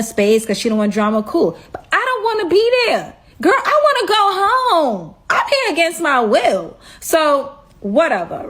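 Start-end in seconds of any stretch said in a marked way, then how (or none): 1.7-6.8 high, fast, laughing whine about wanting to go home; whiny complaint. Real, not acted. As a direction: complain like you're stuck there against your will. Complaining she wants to leave.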